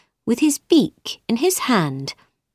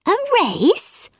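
surprised; 13 semitones higher than usual. A narrator's reading. Alice surprised question.

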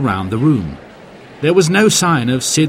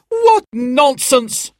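slow, high-pitched outraged heckle; indignant high shout; shouted scorn; much slower than usual. A narrator's reading. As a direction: cry out in disbelief from the crowd. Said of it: "shouted a woman".

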